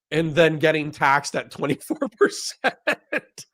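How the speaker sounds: slow line dissolving into real laughter; laughing. Real, not acted. Host imagining a libertarian getting taxed, cracking up. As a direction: lose it toward the end. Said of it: "I'm sorry for laughing".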